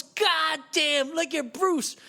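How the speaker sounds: loud, high hype-man holler; hollering onlooker. Retold or imitated. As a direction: announce the coming fight across the room, hammed up. Onlooker hollers across the room.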